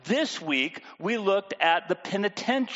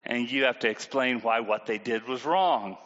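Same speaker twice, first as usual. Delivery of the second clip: usual speaking voice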